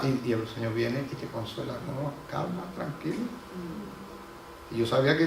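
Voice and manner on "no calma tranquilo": gentle consolation. Pretend. God consoling the failing believer.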